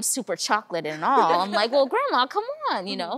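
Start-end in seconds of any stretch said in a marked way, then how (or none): 1.7-2.9 high, louder exasperated protest; incredulous plea to grandma. Retold or imitated.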